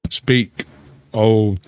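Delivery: ordinary delivery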